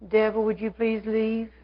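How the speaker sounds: soft, slow, timid request; mock-meek pleading; meek polite plea. Shown as a demonstration. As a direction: ask very politely and quietly, as if begging a favor. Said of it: "you're not gonna say".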